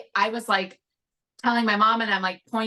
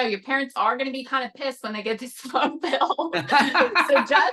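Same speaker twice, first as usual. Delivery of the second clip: words breaking into a laugh; 4 semitones higher than usual. Real, not acted. Teen warning a caller about the phone bill, laughing.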